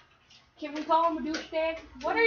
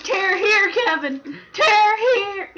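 louder, higher, wailing shout; mock shout; 5 semitones higher, 8 dB louder, slower than usual. Reading the chip bag to Kevin, hammed-up wailing shout. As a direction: yell the bag's instructions like a plea. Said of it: "It says".

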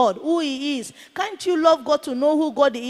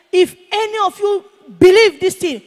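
raised, louder imitation of a warning; angry threat; 7 semitones higher, 8 dB louder than usual. Angry man threatens the pastors who believe, raised warning voice. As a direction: call out high and loud to warn off followers.

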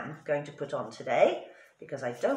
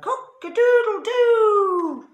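very high, loud, slow crow; rooster crow; 11 dB louder, slower than usual. A narrator's reading. Rooster scares the robbers, long loud crow. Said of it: "And the rooster sang".

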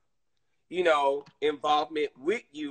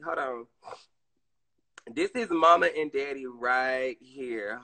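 ordinary delivery

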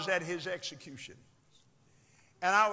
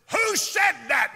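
high, loud demand; startled shout; 10 semitones higher, 12 dB louder than usual. Retold or imitated. Killer slams brakes hearing the tape, acted startled shout. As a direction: shout it out as if alarmed.

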